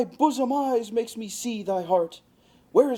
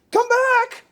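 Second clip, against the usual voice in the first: much higher, louder chasing yell; desperate yell; 7 dB louder than usual. Acted. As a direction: shout after her as she runs off. Lysander chases after Helena.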